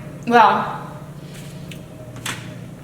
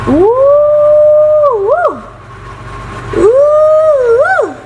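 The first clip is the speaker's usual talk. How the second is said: high, loud ooh-woo whoops; real excited whooping; thrilled whoops; 7 dB louder, much slower than usual. Real, not acted. Admiring the zoomed vegetables.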